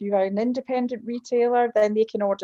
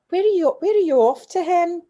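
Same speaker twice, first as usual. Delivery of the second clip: high, louder voice for the kind gate man; airport man; 10 semitones higher, 6 dB louder than usual. Retold or imitated. Glasgow airport man asking the crying girl where she's going, high and loud. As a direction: play the Glasgow gate attendant, raised and bright. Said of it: "And the man said".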